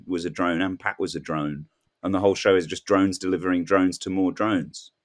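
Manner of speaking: usual speaking voice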